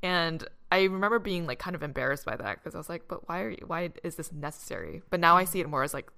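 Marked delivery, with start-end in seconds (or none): none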